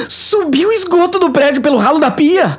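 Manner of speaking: high, squealing panic over dirty water; panicked disgust. Acted. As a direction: shriek in disgusted fright.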